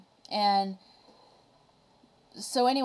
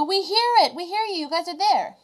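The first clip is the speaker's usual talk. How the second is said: much higher and louder, relieved cry; joyful shout; 9 semitones higher, 7 dB louder than usual. Retold or imitated. Jungle crowd finally hearing the Whos, joyful high shout. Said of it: "They go".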